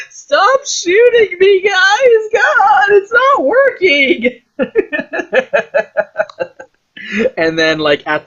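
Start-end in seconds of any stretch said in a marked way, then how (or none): 0.1-4.4 very high, crying, shouted plea; a hammed-up desperate wail to stop the shooting; panicked stormtrooper. Stormtrooper used as a shield begging his squad, high crying mock wail. 4.6-6.6 laughter. Real, not acted. Laughs after own bit.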